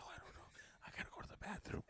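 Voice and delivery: retold whisper. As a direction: breathe it out secretly.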